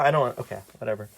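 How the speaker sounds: ordinary delivery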